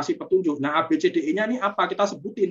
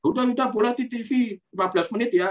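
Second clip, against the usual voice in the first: giving in; 8 semitones higher, faster than usual. Retold or imitated. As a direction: throw up your hands and give in to the child. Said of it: "misalkan gitu".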